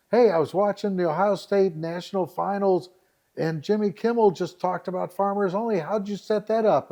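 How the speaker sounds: imitating an amazed friend; excited texter. Friend texting about a TV mention, excited.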